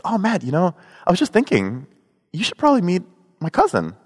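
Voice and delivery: tossing off a casual suggestion; friend quoted. Retold or imitated. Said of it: "was like".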